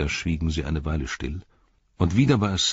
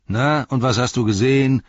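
higher, louder growled challenge; growling small orc; 9 semitones higher, 9 dB louder than usual. Acted. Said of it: "knurrte der Kleine".

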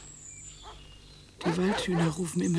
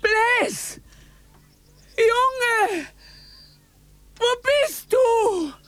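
loud, high, carrying call across a distance; 5 dB louder, much slower than usual. Acted. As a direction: shout for the lost boy, stretching the last words. Calling out for missing boy.

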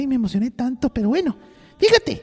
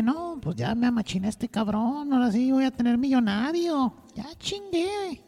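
quiet inner thought; 4 semitones lower, 8 dB quieter than usual. Retold or imitated.

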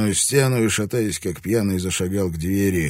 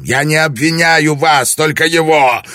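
roared, far louder and higher; roaring; 10 semitones higher, 10 dB louder than usual. A narrator's reading. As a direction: roar it out with full force. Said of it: "проревел Бунтара".

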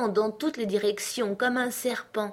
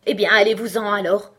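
loud, grumpy dismissal; sullen grumble; 8 dB louder than usual. A narrator's reading. Pigeon telling Alice to go away, sullen. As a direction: send her off crossly, with force. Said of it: "grommela le pigeon d'un ton maussade".

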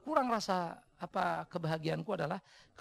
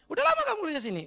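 high-pitched, louder startled question; kaget bertanya; 8 dB louder, faster than usual. Retold or imitated. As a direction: act out being caught off guard, voice jumping up. Menirukan diri kaget bertanya pada jemaat. (bertanya kaget dengan suara meninggi)

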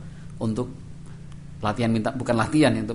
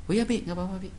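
put-on higher, softer child's voice; forgiving child; 8 semitones higher, 4 dB quieter, faster than usual. Son forgiving his father, higher and softer. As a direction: let dad off gently.

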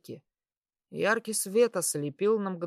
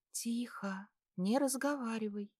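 put-on higher, soft girl's whisper; whispered hushing; 6 semitones higher, 6 dB quieter than usual. A narrator's reading. Girl hushing weak patient, soft whisper. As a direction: hush him tenderly. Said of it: "прошептала она".

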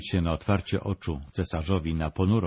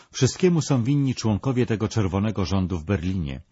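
normal talking voice

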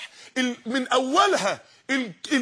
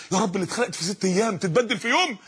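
normal talking voice

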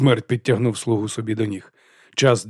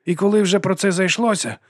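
high-pitched character voice; band member's voice; 9 semitones higher than usual. A narrator's reading. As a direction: pitch it well up, chiming in. Kivun's voiced dialogue.

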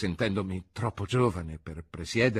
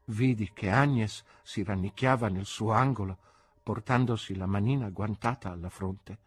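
ordinary delivery

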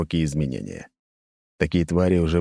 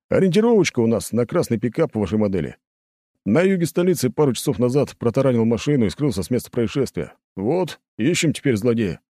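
higher-pitched officer's voice; patrol lieutenant explaining; 6 semitones higher than usual. A narrator's reading. Lieutenant explaining the roadside check to a prince, higher-pitched.

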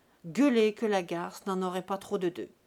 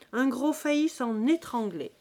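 ordinary delivery, nothing marked

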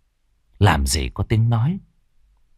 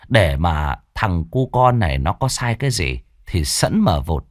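ordinary delivery

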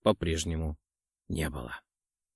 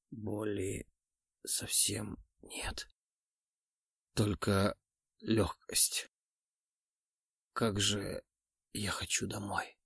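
dying near-whisper; faint whisper; 10 dB quieter, slower than usual. A narrator's reading. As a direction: breathe the words out faintly. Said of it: "тихо проговорил Перк".

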